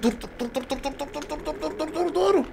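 soft, rising "dur" repeated under the breath; tense hushed pleading. Real, not acted. Streamer willing his helicopter to stop, tense near-whisper. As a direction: beg it to stop in a tense hush.

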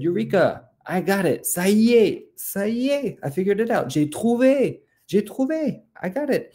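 very high-pitched triumphant exclamations; excited discovery. Acted. Father Rabbit tells the peacock he figured it out, excited. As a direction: squeal it out like a sudden breakthrough.

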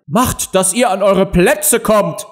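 high, drawn-out roared order; thundering queen. A narrator's reading. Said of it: "donnerte die Königin". (donnernde Königin)

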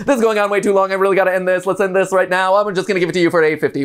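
auctioneer. Shown as a demonstration. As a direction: rattle off bids like an auctioneer. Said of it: "Can you imitate an auctioneer?".

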